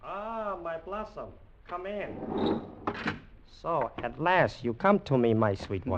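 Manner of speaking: a touch of seductive tenderness; hushed come-on to his lover; softened seduction. Acted. General greets his lover.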